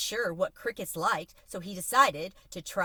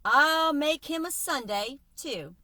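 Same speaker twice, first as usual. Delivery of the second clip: slow, higher, louder call that falls away at the end; calling after them; 7 semitones higher, 5 dB louder, slower than usual. A narrator's reading. Counterman calling a promise to the departing boy, slow call. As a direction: call out a promise to someone walking off. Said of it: "Mickey called after them".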